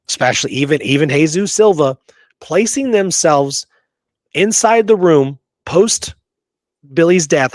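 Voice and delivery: usual speaking voice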